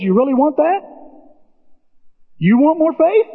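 high teasing Jesus; lightly voiced Jesus teasing. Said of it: "Jesus goes".

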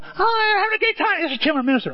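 high, chirpy party host; bubbly introducing the pastor; cheery introduction. Retold or imitated. Imitating church member introducing.

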